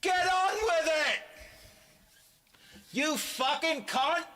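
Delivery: very high, loud mock heckle; heckler's shout. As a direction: shout abuse from the crowd like a heckler. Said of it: "the best thing to heckle".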